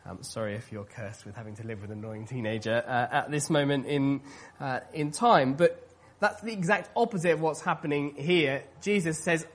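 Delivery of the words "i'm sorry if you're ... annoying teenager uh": ordinary talk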